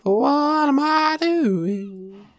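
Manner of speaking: high, slow, drawn-out wail of despair; exasperated wail. Real, not acted. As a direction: moan it at your own blunder. Exasperated at own mistake.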